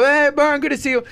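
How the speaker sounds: high, cheery call of a fan on the street; friendly fan greeting. Retold or imitated. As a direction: call out like a happy fan spotting him. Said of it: "Who are the people that are giving you love?".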